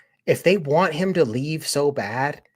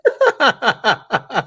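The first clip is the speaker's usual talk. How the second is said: loud amused laugh; burst of laughter; 7 semitones higher, 7 dB louder than usual. Real, not acted. Mocking the staff member's words.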